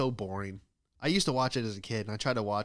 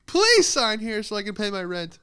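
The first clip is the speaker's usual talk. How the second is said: mock pleading; 10 semitones higher, 8 dB louder than usual. Begging after mock sob.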